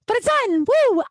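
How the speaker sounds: high, bright whoop of relief; excited cheer. Real, not acted. Celebrating to listeners that the book is done, high excited whoop. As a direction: cheer it like a finish line.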